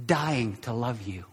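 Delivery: low, soft and tender; tender reassurance. Real, not acted. Preacher assures listeners God loves them, tenderly. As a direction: drop the voice to reassure gently.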